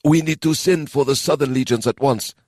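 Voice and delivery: a touch of anxious urgency; anxious quivering voice. Acted. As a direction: minister pressing, lightly worried. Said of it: "anxiousness in the Minister's quivering voice".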